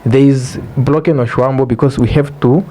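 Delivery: ordinary delivery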